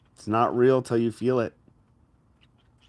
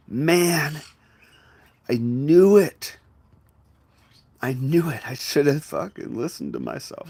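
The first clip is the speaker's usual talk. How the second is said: exasperated regret; 6 semitones higher, 5 dB louder than usual. Acted. Kicking yourself afterwards for not listening, exasperated. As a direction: kick yourself out loud, voice pushed up.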